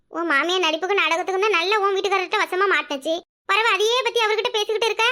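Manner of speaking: usual speaking voice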